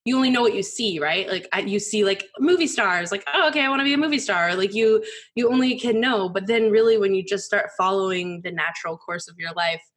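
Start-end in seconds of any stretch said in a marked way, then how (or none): 3.3-4.5 high, loud, quick gush; naive excitement. Retold or imitated. Host mimics her younger self dreaming of movie stars, naive excitement. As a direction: gush it like a starry-eyed wannabe.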